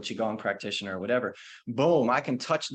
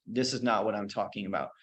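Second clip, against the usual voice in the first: ordinary talk, nothing marked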